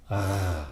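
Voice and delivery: "R" scraped at the back of the throat; throaty, held "R"; spoken French R. Shown as a demonstration. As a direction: say "R" as a French uvular rasp. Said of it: "when spoken in French".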